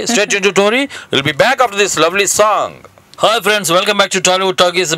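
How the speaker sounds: bright, slow radio host voice; radio announcer. Acted. Radio host sign-off and welcome. (Radio anchor స్వరం)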